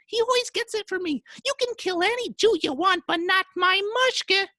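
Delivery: very high falsetto wife; hammed-up falsetto, pleading her case to the husband; scolding wife. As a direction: complain in a comically high wife voice.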